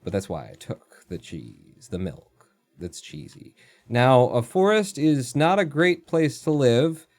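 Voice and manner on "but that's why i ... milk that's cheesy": odd mumbled voice. Pretend. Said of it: "I don't know why I'm talking like this".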